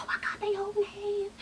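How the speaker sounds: tiny high, soft squeal; mock gushing. Vlogger cooing at the hand-holding reunion, high mock gushing. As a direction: squeak it softly, mock-touched.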